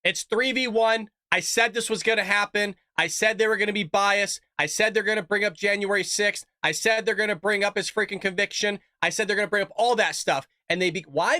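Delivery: usual speaking voice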